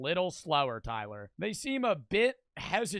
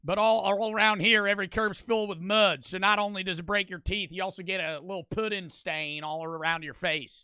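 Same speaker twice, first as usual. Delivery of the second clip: put-on Southern accent. Streamer describing a curb stomping, hammed-up Southern accent. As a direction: spin it out like a Southern local. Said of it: "Stop making me do accents".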